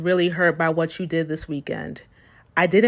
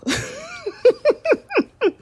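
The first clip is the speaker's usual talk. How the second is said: high burst of laughter; big laugh. Real, not acted. Rereading own apology text, high burst of laughter. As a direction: crack up at yourself.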